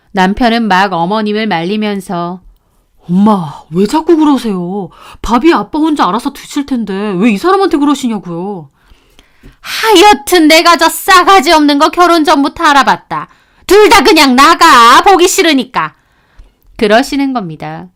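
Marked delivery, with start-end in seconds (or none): 3.1-8.7 lightly voiced husband. Son pleading with mother to stop, placating. 9.6-15.9 higher, louder dismissal; mother-in-law angry. Acted. Mother-in-law ordering couple out, angry.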